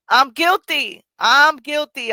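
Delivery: high, loud, laughing admission of guilt; animated confession. Real, not acted. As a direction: own up loudly through a laugh, pitch leaping up. Laughing confession of own combative habit.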